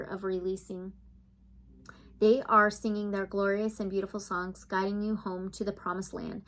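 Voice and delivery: soft guided meditation read. Acted. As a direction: read it like a soothing spiritual meditation.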